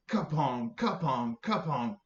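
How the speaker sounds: three ringing kapong knocks, each falling; rope-knot sound effect. Acted. Storyteller ties Lion to the tree, sounding each knot. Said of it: "it sounded a little bit like this".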